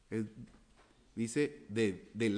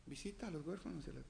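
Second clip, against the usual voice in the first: hushed quick aside; 5 semitones higher, 11 dB quieter, faster than usual. Acted.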